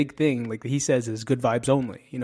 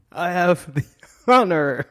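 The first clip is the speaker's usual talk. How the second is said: loud, higher, hammed-up heroic proclamation; Thor; 6 semitones higher, 8 dB louder than usual. Trying to sound like an Avenger, mock-grand proclamation. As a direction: boom it out as a badly faked superhero. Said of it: "I wanna sound like Thor from the Avengers".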